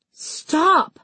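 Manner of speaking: high, urgent cry. A narrator's reading. Heroine lunges to save her beloved, crying out. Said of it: "I cried".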